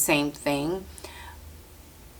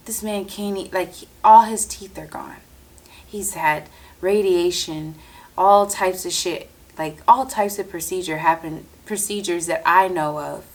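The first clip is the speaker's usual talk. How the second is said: ordinary talk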